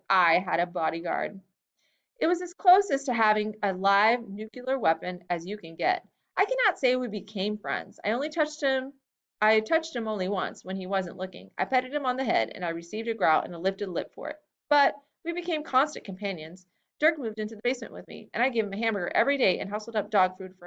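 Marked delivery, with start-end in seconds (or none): none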